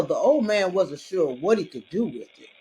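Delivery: ordinary delivery, nothing marked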